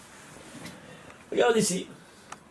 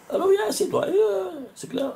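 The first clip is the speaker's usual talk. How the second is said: eager invitation. Real, not acted. Teacher inviting student to look.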